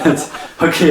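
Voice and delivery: laughing disbelief, pitched high. Real, not acted. Comic laughs at the shelter's irony.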